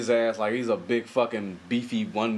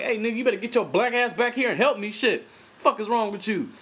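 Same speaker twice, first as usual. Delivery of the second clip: imagined angry yell; 12 semitones higher than usual. Retold or imitated. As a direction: scream at him to come back and help. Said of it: "I would've been like".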